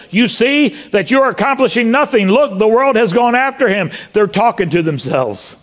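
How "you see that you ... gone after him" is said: high-pitched, frustrated complaint; acting out rattled schemers; exasperated Pharisees. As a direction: grumble urgently to your fellows, pitched well up. Acting out exasperated Pharisees among themselves.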